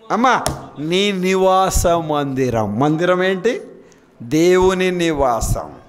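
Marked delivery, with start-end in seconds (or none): 0.1-2.8 slow repeat. Shown as a demonstration.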